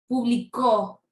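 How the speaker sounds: publicó with a strong, long last syllable; pu-bli-CÓ, force on the ending; stress on final CÓ. Shown as a demonstration. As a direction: hit the CÓ at the end of publicó. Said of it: "toda la fuerza de voz".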